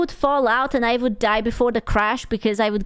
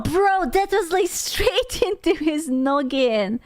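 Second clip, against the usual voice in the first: ordinary talk, nothing marked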